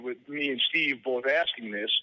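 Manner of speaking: ordinary talk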